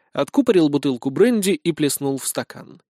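ordinary delivery

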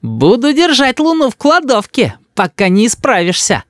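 put-on high, cheeky child voice; insolent. A narrator's reading. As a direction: defy mother like a brazen little boy. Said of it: "нагло сказал Рис".

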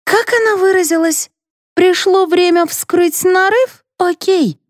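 high-pitched sarcastic quoting; mocking echo. A narrator's reading. Mother throwing the narrator's words back, mocking. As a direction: parrot her words in a high, taunting voice.

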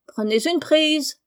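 comic parrot calling from its perch; parrot cry. A narrator's reading. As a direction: drag it out like a parrot. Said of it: "cria Polly".

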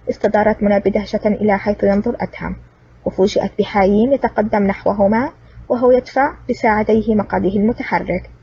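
ordinary delivery, nothing marked

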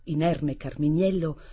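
ordinary delivery, nothing marked

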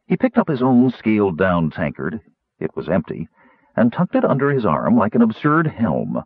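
ordinary delivery, nothing marked

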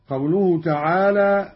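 usual speaking voice

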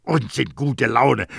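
furious dismissal, acted in narration; angry self-rebuke. A narrator's reading. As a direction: scoff angrily at your own enthusiasm. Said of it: "schleuderte das Tüchlein wütend".

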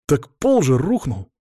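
slow, disbelieving protest; aghast. A narrator's reading. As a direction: insist in dismay. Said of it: "взялся за голову Антон".

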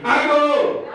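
high shouted cue to the audience; ringing call-and-response shout. Shown as a demonstration. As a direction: shout it high, expecting an answer. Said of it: "Me, I say, "I go".

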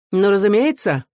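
a touch of sneering amusement; smug, mildly mocking exclamation; smirking exclamation. A narrator's reading. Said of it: "воскликнул офицер, усмехаясь".

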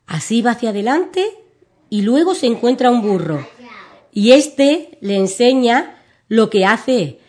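put-on lively children's story reading; storytelling for kids. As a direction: read it like a bedtime tale for little ones. (lectura de cuento infantil)

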